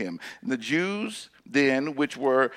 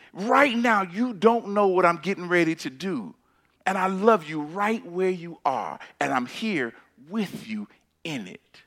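usual speaking voice

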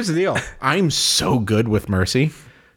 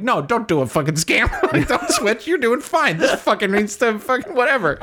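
laughing; 8 semitones higher than usual. Retold or imitated. Friend's voiced pushback, laughing.

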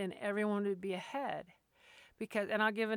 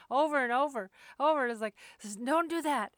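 amused, higher and louder through laughter; laughing; 4 semitones higher, 5 dB louder than usual. Real, not acted. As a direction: laugh while recounting the pointless repetition. Laughs at handler's useless repeated command.

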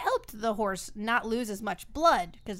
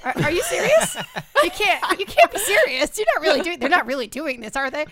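disbelief; 6 semitones higher, 6 dB louder than usual. Retold or imitated. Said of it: "I was like".

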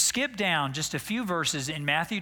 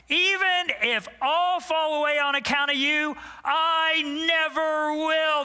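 pitched-up proud promise; Peter's bold boast; 7 semitones higher than usual. Acted. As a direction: swear loyalty like a cocky disciple. Preacher acting Peter's boast.

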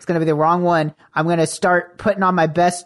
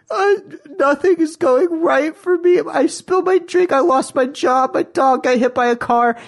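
fake sobbing plea; 8 semitones higher than usual. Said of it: "I'm crying so hard".

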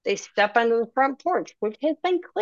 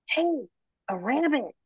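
hushed, soft and higher-pitched; quiet exclamation; 6 semitones higher, 6 dB quieter than usual. A narrator's reading. Gustav to his companion on spotting a rabbit, quietly. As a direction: say it softly, as if not to startle. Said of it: "Gustav says quietly".

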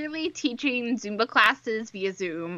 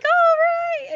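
very high, loud imitated 'all right'; mock excited reaction; 7 dB louder than usual. Co-host mimicking him at Zumba, high and loud. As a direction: pitch it way up, playfully.

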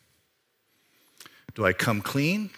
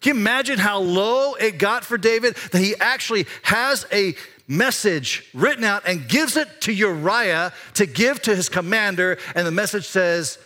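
preaching at full force; 8 semitones higher than usual. Real, not acted. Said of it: "preaching climax".

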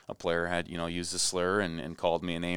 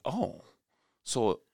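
dawning realization; 12 semitones higher than usual. Retold or imitated. Boy realizing what the coach meant, surprised and high. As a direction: realize it bit by bit, voice up. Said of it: "it's like".

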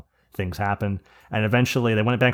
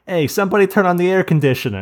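put-on higher, louder call of a drunk Jersey Italian; loud drunk Jersey guy; 9 semitones higher, 7 dB louder than usual. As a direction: bellow it across the room like a tipsy Jersey local. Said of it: "the loud, drunk Jersey Italian kind of sort of people".

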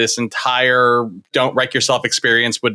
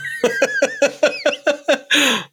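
jolly Santa laugh; 11 semitones higher than usual. Acted.

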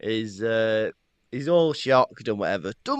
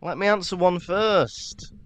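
much higher, slow hammed-up old auror calming a boy; drawn-out mock gruff teacher telling him to slow down; Mad-Eye Moody impression; 8 semitones higher, slower than usual. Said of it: "Mad-Eye Moody".

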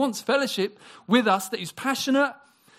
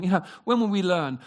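usual speaking voice

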